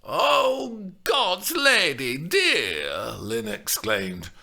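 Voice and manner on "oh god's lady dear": high, loud, slow hammed-up offence; mock shock. A narrator's reading. Nurse feigns shock at Juliet's cheek. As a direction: play outraged, overdone. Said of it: "pretending she was shocked and offended".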